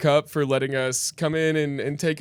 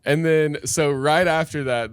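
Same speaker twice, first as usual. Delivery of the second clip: usual speaking voice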